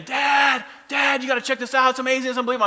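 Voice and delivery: put-on high, excited child calling for dad; excited kid yelling. Son yelling excitedly for his dad upstairs. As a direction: call out thrilled like a little boy.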